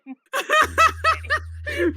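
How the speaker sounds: big cackling peal of laughter; loud high laugh. Real, not acted. Cracking up with a friend over casting, loud laugh.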